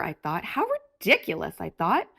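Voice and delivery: usual speaking voice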